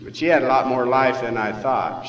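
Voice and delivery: ordinary delivery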